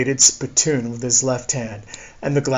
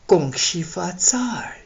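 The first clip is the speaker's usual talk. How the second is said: slow, high snake voice; slow serpentine hiss; 5 semitones higher, 3 dB quieter, slower than usual. A narrator's reading. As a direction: draw it out like a hissing snake.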